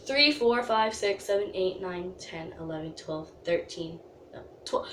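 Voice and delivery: soft, low count, sinking away; tally under your breath, pitch dropping; counting to oneself. Real, not acted. Counts the hours to herself.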